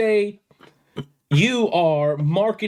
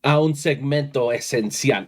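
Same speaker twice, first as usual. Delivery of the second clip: lucha libre announcer; 3 semitones higher than usual. Acted. Podcast host opens show announcer-style.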